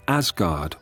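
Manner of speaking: usual speaking voice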